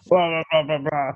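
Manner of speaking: lightly mimicked low male mumble; men talking to men. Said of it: "they talk lower and more slowly".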